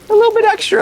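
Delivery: high, hammed-up showy announcement; showman flourish. Shown as a demonstration. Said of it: "sometimes it's nice to put on a show".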